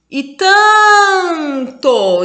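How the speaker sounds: slow, drawn-out demo of a word; slow stretched reading. Shown as a demonstration. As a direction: hold each syllable to show the count. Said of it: "eu não conto aqui também".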